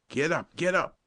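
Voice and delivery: high, urgent call with sudden hope; hopeful calling out. A narrator's reading. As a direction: call to teammates, voice lifted in hope. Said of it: "called Sidington, a sudden note of hope in his strained voice".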